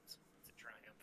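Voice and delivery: unvoiced hushed whisper. Real, not acted. GM whispers a question during the mic silence. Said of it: "So it looks like it".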